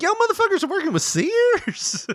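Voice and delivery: high, loud mock disbelief; very high incredulous mock outburst; mock outrage. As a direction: cry out in hammed-up astonishment. Acts out outraged reaction, laughing.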